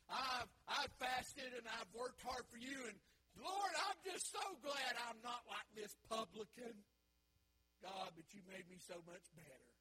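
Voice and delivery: hammed-up Pharisee shouting his virtues; boastful shout. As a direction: brag to God at full volume. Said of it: "I'm putting it in Dave".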